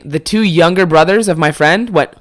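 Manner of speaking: sharp shocked intake of breath; mock gasp. Brothers at dinner hearing oh my God, gasping. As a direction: imitate a scandalised gasp.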